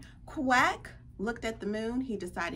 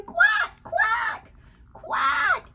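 high, loud shouted quacks; excited quacking; 8 dB louder, much slower than usual. Acted. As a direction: greet a returning friend in squawks. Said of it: "Everyone's so excited to see him".

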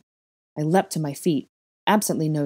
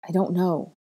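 ordinary delivery, nothing marked

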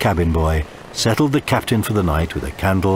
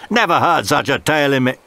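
triumphant officer; 7 semitones higher than usual. Acted. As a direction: gloat as you make the arrest. Officer scoffs.

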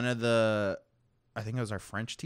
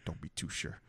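mock ominous whisper; 6 semitones lower, 11 dB quieter than usual. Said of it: "He's like".

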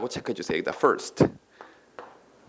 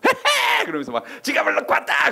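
high, giggling, manic glee; giddy crazy person; 13 semitones higher than usual. Retold or imitated. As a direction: squeal it with manic delight. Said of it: "이건 정신병자죠".

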